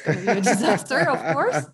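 breaking into laughter; laughing. Real, not acted. Teacher joking about students' couscous disaster, laughing. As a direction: say it through a growing laugh.

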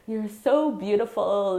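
emphatic admiring quote. Retold or imitated. Sister insisting she is beautiful, slow and emphatic. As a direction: press the compliment home, slowly. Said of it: "And she was like".

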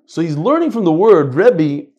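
ordinary delivery

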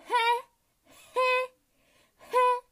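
high, spaced-out "heh"s; three cheeky high titters; gloating mock giggle. July gloating over June's stolen glory, mock giggle.

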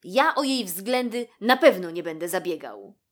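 loud, emphatic refusal; vowing. A narrator's reading. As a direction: swear off it as the rabbit. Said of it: "zarzekał się". (stanowcze zarzekanie się)

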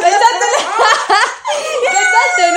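loud, high laughing shout. Real, not acted. Host proudly checks her friend got the reference, laughing loud. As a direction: crow it out through laughter.

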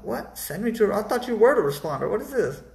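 high, incredulous questioning; retold bewildered objection; baffled protest. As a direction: push the pitch up in mock disbelief. Baffled at being passed to responder.

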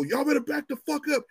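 high mock yell from the officer; imitating a cop yelling at a crowd; retold yell. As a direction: bark it like a cop clearing a doorway. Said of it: "I hear him go".

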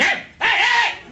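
high, shouted mock outrage; hammed-up furious protest; upset indignant star. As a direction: imitate an offended celebrity yelling denials. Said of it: "He got all upset".

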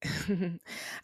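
quiet little real laugh; soft laugh. Real, not acted. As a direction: let a soft laugh slip out. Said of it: "I'm laughing".

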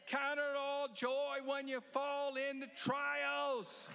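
slow, high, loud verse proclaimed; preacher calling out the verse to the crowd; proclaimed scripture. Acted. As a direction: ring the verse out slowly. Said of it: "That's why it says".

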